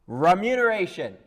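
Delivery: booming, high and loud; loud proclamation. Acted. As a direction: announce the word to the world. Costard tries the word grandly.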